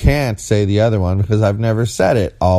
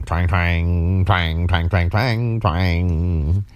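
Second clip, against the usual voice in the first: guitar twang imitation; much slower than usual. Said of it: "playing her hair like it's a guitar string".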